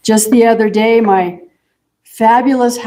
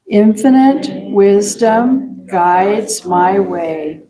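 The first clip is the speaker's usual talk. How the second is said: slow, spaced-out affirmation, word by word; very slow reading; much slower than usual. Shown as a demonstration. Leader reading an affirmation together with congregation, very slowly. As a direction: read it very slowly so it sinks in. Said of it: "let's read it very slowly".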